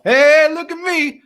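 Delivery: imitating a late student playing to the class, loud and showy; showing off. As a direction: ham up a show-off student demanding attention. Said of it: "she'd make a big show".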